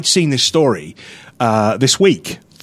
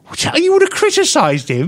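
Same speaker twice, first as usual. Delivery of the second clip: pitched way up in disbelief; shocked question to the cohost; incredulous; 13 semitones higher than usual. Real, not acted. Host disbelieving his cohost, high and incredulous.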